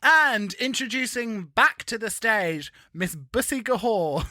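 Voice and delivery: slow, grand show introduction; playful drag-show host build-up; mock stage announcer. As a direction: announce the act with drawn-out ceremony.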